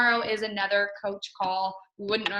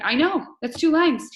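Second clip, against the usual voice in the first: higher, louder mock exasperation; hammed-up retold exasperation, higher; exasperated; 8 semitones higher, 7 dB louder than usual. Retelling her panicked answer to the doctor, higher and louder.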